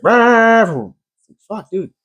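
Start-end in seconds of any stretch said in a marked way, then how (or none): 0.0-0.9 high, sustained revving machine noise; imitated machine roar. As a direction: mimic a mower engine screaming at full throttle. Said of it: "when it's like".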